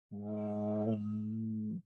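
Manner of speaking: long, level humming whoosh; slow imitated rush of energy; drawn-out vroom. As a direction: stretch it like energy surging out. Imitating energy surging out of him.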